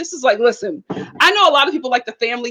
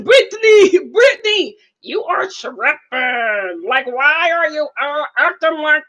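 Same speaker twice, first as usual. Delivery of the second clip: mock Yazan; slower than usual.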